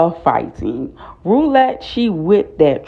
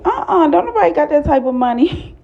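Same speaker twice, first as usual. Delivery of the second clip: high, indignant imitated refusal; sassy refusal; 11 semitones higher, faster than usual. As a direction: scoff at the idea, mock outraged. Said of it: "Uncle Clifford's like".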